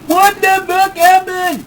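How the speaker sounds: high, loud, slow shout; dazed shout. Acted. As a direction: yell out in confusion. Character wakes up dazed.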